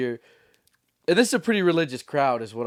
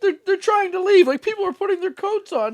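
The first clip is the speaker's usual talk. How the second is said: much higher, frantic pleading through laughs; panicked booker; 6 dB louder than usual. Retold or imitated. Booker begging the comic to act, mock frantic. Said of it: "it's like".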